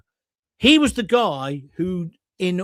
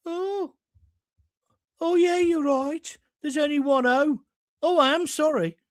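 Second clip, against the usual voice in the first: sheepish Brummie cleaner; 9 semitones higher than usual. Retold or imitated. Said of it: "Amy Turtle was the Brummie cleaner".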